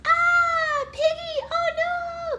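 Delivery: very high playful squeal of fright; scared piggy. Pretend. Mother shows her toddler what the scared piggy says, mock-frightened. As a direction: squeal like a frightened little pig. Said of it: "When the piggy's scared, he goes".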